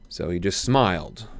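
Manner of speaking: ordinary delivery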